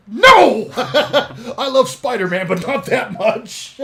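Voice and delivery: loud, high hammed-up protest through laughter; mock shouted refusal. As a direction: yell a comic refusal, cracking up, drawn out. Acted refusal of the dare.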